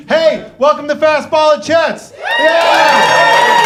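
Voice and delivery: high, loud hype-up shout; real, full-voiced emcee shout; shouted welcome. Real, not acted. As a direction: holler the welcome to a cheering crowd. Host shouting welcome to crowd.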